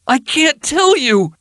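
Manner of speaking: high, slow desperate plea; in desperation. Acted. Ruffian desperately refuses Erilyn, high and slow. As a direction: plead in desperation. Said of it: "he said in desperation".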